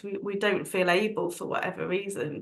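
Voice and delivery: usual speaking voice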